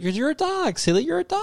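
high singsong pet talk; owner answering dog. Retold or imitated. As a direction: imitate an owner telling a dog, high-pitched. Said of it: "couldn't have the answer".

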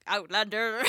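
a bad mock Scottish accent on one word; hammed-up Scottish Outlander voice. Host teasing her co-host with a mock Scottish accent. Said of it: "So I'm just like".

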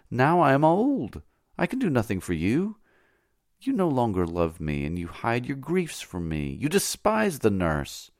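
put-on high, pleading old nurse; old slave-nurse begging her young mistress, high and pleading. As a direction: beg her mistress not to leave her. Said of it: "tears of tenderness and vexation".

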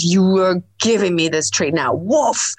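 a hint of a bossy dog barking woof; host's dog demands a treat while she's on the phone, mock barking; demanding dog. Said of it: "this consistent".

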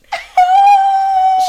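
loud, high-pitched squeal of laughter. Real, not acted. Co-host reacts to the dinner reveal, high squealing laugh. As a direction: squeal with amused excitement.

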